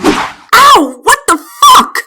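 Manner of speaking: sudden loud pained outburst; pained yelp. Acted. Princess nicked by the fencing blade, loud pained yelp. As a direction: cry out in shock and pain.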